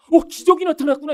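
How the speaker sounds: high, loud acted astonishment; amazed. Believer spotting a rare David-like trait within, acted amazement. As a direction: exclaim as if seeing a miracle. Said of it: "이렇게 놀라셔야죠".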